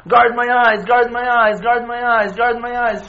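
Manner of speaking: a fervent plea that runs out of steam; pleading repeated prayer. Retold or imitated. Praying to Hashem for years, loud repeated plea fading. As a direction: start loud and fade with each repeat.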